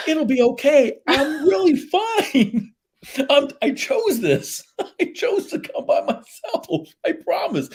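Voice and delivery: high, slow, laughing through the words; laughing reassurance. Retold or imitated. Solo guest laughingly assuring kind strangers, laughing. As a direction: reassure them while cracking up.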